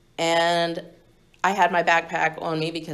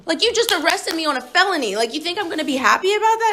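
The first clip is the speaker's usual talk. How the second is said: incredulous outburst; 11 semitones higher than usual. Retold or imitated. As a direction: scoff at the cop in disbelief. Angry retort to the booking cop.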